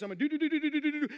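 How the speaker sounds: lightly hammed-up self-absorbed busyness; mock busy chatter. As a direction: rattle off the do's like a busy go-getter. Mimicking busy self-driven plans.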